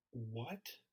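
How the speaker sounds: very soft, stunned question rising at the end; hushed disbelief. Retold or imitated. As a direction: barely voice it, retelling your own stunned reaction. Stunned reply to mentor's message.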